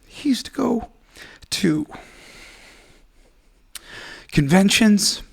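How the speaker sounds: high, tight voice still close to tears; talking about a dead friend while crying; tearful. Real, not acted. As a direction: praise a late friend through tears. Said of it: "making me cry so much".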